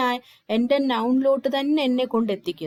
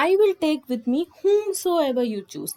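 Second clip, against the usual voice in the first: slow, raised example read; 6 semitones higher, 4 dB louder, much slower than usual. Shown as a demonstration. Teacher reads example loudly, slowly.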